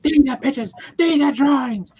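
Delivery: high, loud scornful teacher; mock teacher yell. Teacher yelling at a kid, comparing drawings. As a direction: bark it out like an angry old teacher. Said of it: "he's like".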